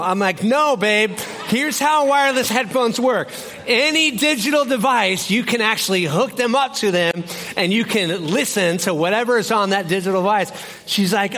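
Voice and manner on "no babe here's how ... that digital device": raised, high lecture to his wife; mock-patient shouting husband. Explaining headphones to wife.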